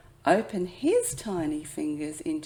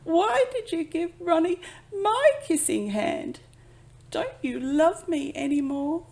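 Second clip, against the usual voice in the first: put-on hurt child; 11 semitones higher, 7 dB louder than usual. A narrator's reading. Hurt child questions mother.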